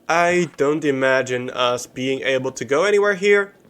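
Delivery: ordinary delivery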